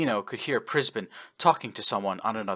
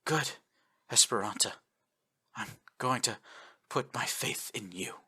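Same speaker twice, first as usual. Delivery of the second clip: growing softer, fading out; 7 dB quieter than usual. A narrator's reading. Dying admiral to officer, fading soft voice. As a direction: let the voice slip away. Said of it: "voice was getting fainter".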